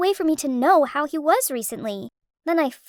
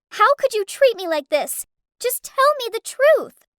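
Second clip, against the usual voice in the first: a high, loud demand for the truth; shouting; 8 semitones higher, 5 dB louder than usual. Acted. Girl shouting at her boyfriend down the phone, high and loud. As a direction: yell it at him, voice climbing. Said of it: "I shouted".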